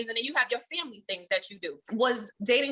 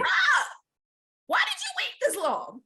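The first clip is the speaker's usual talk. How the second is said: much higher, mock-exasperated question; incredulous high bruh; 13 semitones higher than usual. Teasing the boyfriend for waiting so long, high mock exasperation. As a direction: toss it up high in playful disbelief. Asking her boyfriend why he waited.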